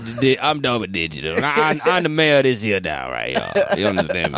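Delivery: put-on Cajun accent. Mock Cajun mayor boasting.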